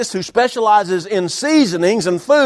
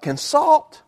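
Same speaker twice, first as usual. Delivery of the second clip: puzzled question; 2 semitones lower, much slower than usual. Retold or imitated. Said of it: "I thought".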